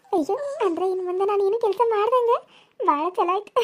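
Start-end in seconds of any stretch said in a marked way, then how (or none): 2.8-3.6 laughing. Acted. Sister-in-law laughs at scolding.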